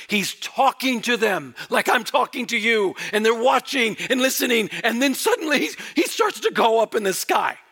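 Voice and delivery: rising excitement. Acted. Preacher picturing the ascension for the congregation, building excitement. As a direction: let the wonder climb as he rises.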